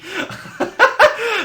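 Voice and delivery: loud, very high burst of laughter; squealing laugh. Real, not acted. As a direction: burst out in an embarrassed high cackle. Laughs at own awkward hint.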